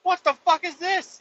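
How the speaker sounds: high, incredulous question; stunned at what he is looking at; disbelief. Retold or imitated. Trucker seeing the chained wolves up close, high disbelief. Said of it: "and I'm like".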